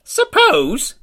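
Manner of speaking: high, loud falling Suppose? of disbelief; indignant exclamation. A narrator's reading. Old lady scandalised at her son's doubt, high falling Suppose?. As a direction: spit out Suppose? as an affronted old woman. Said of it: "ejaculated the old lady".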